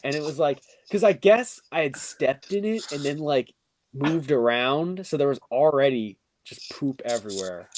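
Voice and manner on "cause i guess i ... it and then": ordinary delivery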